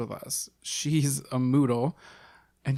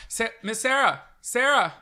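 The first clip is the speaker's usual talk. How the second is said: high, bright reporter voice; gushing red carpet chatter; 10 semitones higher, 8 dB louder than usual. Pretend. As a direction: chase the star down the carpet. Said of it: "I have to get in character".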